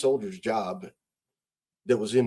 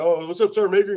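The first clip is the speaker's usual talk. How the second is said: cheerful greeting; 8 semitones higher, 7 dB louder, faster than usual. Retold or imitated. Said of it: "they're like".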